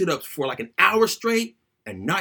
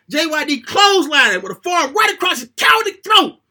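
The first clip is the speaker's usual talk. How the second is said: excited play-by-play; 7 semitones higher, 5 dB louder than usual. Acted.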